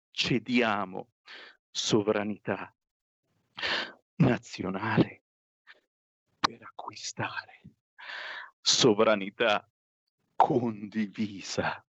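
slow, word by word with long pauses; pained, halting reading. Acted. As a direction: force out each word reluctantly. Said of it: "Non riesco a ripeterla questa frase".

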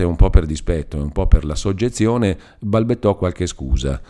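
usual speaking voice

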